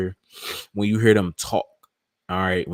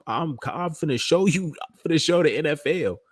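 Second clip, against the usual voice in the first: cocky rookie; 7 semitones higher than usual. Retold or imitated. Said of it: "These rookies are coming in talking like".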